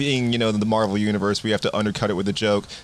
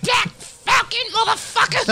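much higher, slow, shouted call; kid yelling; slower than usual. Retold or imitated. Kid calling out to Sam, high drawn-out yell. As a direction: yell it across the street like a kid.

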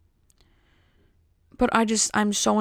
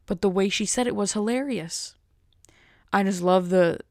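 ordinary delivery

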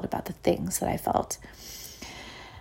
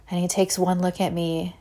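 normal talking voice

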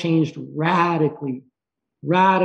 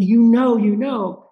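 louder, higher quoted insistence; insistent urging; 5 semitones higher, 4 dB louder, slower than usual. Retold or imitated. Friend insists to the doubting dreamer, emphatically. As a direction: press it home, then ease slightly.

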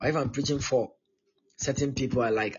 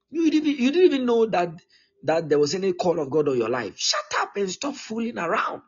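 high, loud rebuke; forceful preaching; 5 semitones higher, 5 dB louder than usual. Real, not acted. Preacher scolding sons who resent the pastor, loud and high. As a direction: drive it home from the pulpit.

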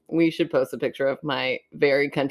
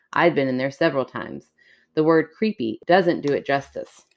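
story narration; 2 semitones lower than usual. Acted. Reads a creepy story aloud.

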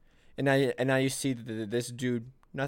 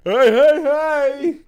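very loud, high catchphrase bellow; hammed-up comedian's catchphrase, blasted out; Bill Cosby; 13 dB louder, much slower than usual. Host mimics Bill Cosby's comeback, hammed-up shout.

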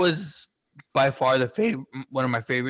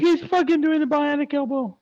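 tearful outburst; 4 dB louder than usual. Retold or imitated. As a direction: voice cracking way up, louder, close to tears. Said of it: "crying my ass off".

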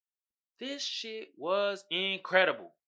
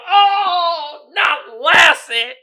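loud, slow, begging shout for the dog; hammed-up desperate shout to spare the dog; mock screaming plea; 5 semitones higher, 13 dB louder, much slower than usual. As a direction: scream it like a horror fan losing it over the dog. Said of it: "the dude that's screaming for dear life".

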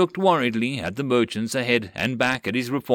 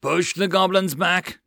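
loud roared command, higher; roaring order; 7 semitones higher, 3 dB louder than usual. Acted. John ordering his crew to repel goblins, roared. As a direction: bellow it across the deck. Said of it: "John roared".

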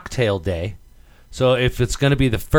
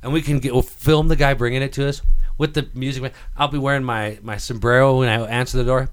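usual speaking voice

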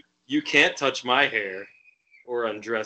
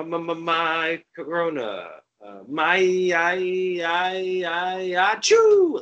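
fake sneeze; 6 semitones higher, 4 dB louder, slower than usual. Fake sneeze joke, Corona.

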